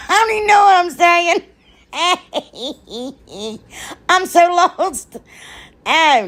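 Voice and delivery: high, laughing squeal through the words; giggling at herself. Real, not acted. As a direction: giggle out the confusion at yourself. Giggly self-mocking confusion.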